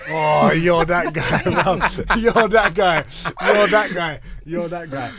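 loud, slow, laughing jab at a friend; laughing teasing. Real, not acted. Teasing his mate over the unopened drink, laughing loud. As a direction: cackle it out while teasing.

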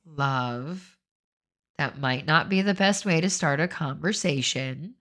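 soft, patient scolding; gentle correction. Retold or imitated. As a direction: let him down kindly, quietly. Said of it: "I might be like".

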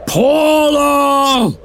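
stern fatherly bellow. Acted. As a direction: call her name sharply, then scold. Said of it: "bellowed Dad".

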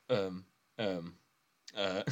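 mock stalling, with long gaps between fillers; stumped hesitation. Daddy Pig stumped by the exchange student's question, mock hesitant. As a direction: play at being lost for an answer.

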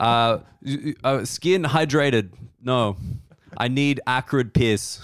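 put-on slow, halting German-accented broken English; bad Dutch-German accent, drawn out; mock German accent. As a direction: play a deliberate comic German. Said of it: "the Dutch accent as a German guy".

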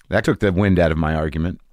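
usual speaking voice

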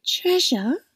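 feigned nonchalance. A narrator's reading. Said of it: "whispered Crystal, with an attempt at nonchalance".

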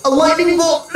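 high, strained, coughing out words; coughing wounded woman. Acted. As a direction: choke the words through smoke. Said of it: "Grace is coughing through the smoke".

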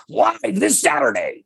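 booming monster truck rally announcer. Shown as a demonstration. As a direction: sell it like a Sunday stadium promo. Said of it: "I can do the big monster truck rally voice".

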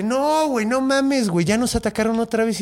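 high, fed-up complaint; king telling the hero they were attacked again; exasperated king. Retold or imitated. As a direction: tell him the bad news with disbelief. King reports new attack.

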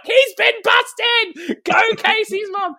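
loud, high, drawn-out cheer; slow, high gleeful shout, a laugh breaking in. Retold or imitated. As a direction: whoop it like a viewer yelling at the TV. Cheering as audience member.

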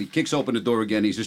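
normal talking voice

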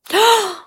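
sharp, high gasp; sudden horrified intake of breath; startled gasp. Retold or imitated. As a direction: gasp as if something just jumped. Startled gasp as spiders jump.

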